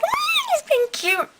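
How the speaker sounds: high, cooing mock-adoring voice. Fan watching Gavroche, cooing very high. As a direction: coo it way up high like it's adorable. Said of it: "I'm just like".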